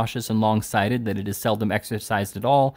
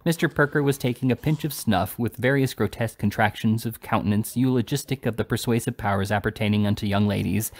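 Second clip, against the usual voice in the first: plain reading voice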